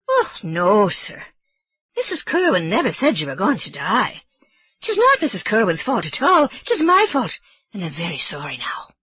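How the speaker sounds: put-on old Irish housekeeper explaining herself nervously; Mrs Leahy.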